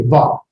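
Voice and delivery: "va" with the B softened to a v; soft B. Shown as a demonstration. Teacher sounding out bet, soft v. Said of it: "but a soft B".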